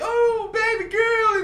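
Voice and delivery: high, drawn-out mock adoration; mock cheering. Husband mocking the crowd cheering the young dancer, cooing. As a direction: coo it slowly like a doting supporter. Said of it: "y'all over here clapping and cheering".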